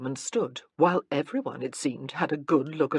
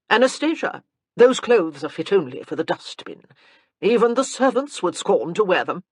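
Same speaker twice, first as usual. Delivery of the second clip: put-on louder, haughty old grandmother; lofty grande dame, louder; imperious dowager; 3 semitones higher, 8 dB louder than usual. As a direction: look down on her while granting a favor. Dowager Countess speaks.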